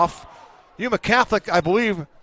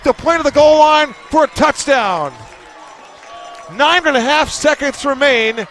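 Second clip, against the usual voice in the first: excited play-by-play; 5 semitones higher than usual. Real, not acted. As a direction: build to the touchdown at full excitement. Goal line touchdown called live.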